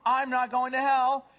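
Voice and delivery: high, loud mock rejoicing; relieved cat convert. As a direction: celebrate the escape at full voice. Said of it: "and say".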